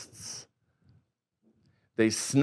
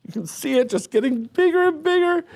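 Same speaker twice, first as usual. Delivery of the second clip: excited; 12 semitones higher, faster than usual. Acted. Excited egg-hatching build-up.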